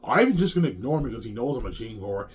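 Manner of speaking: loud mock grievance; pissed off, imitated. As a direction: imitate an indignant partner complaining. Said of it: "And she's pissed off".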